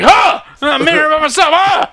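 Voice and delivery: high, loud hammed-up grunting; mock angry yelling. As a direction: yell at yourself in fake rage.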